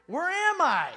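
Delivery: loud, high call from the car; lost traveler calling. Retold or imitated. Traveling man calling to the little boy for directions, loud and high. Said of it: "he said to the little boy".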